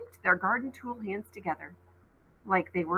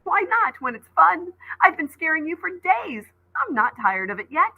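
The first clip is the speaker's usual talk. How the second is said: laughing; 9 semitones higher, 3 dB louder than usual. A narrator's reading. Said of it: "Seth stepped back, laughing".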